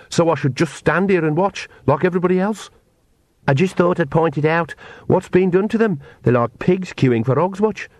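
a wry second voice in her head; sardonic inner voice. Acted.

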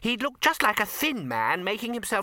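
high-pitched, excited wizard with an idea; eager wizard. A narrator's reading. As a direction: pitch a silly disguise in a high voice. A wizard proposes a disguise.

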